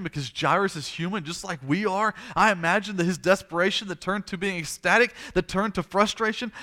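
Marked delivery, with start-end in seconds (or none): none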